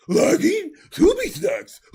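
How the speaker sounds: high, loud cartoon dog voice; Scooby-Doo voice. Playful Scooby-Doo reply.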